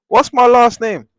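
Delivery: high, loud mocking girl voice. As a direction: squeal it like the girl begging for a drawing.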